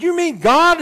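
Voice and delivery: high, loud disbelieving question; astonished. Acted. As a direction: blurt it out in mock amazement. Man astonished at the voice.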